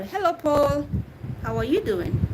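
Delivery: ordinary talk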